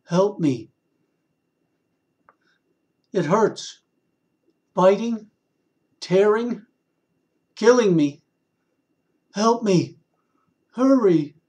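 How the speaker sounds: high, loud cry for help; desperate plea. A narrator's reading. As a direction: beg in terror and pain. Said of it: "a cry that screamed out in terror and pain".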